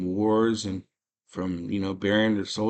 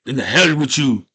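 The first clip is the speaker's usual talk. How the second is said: louder, higher mock-defiant burst; defiant outburst; 4 semitones higher, 8 dB louder than usual. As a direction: throw it out loudly, acting fed up with the god. Prayer turns to defiance.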